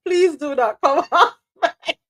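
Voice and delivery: request broken by a laugh; giggling mid-request to the host; laughing through it. Real, not acted. Host asks guest to stay on mic, laughing.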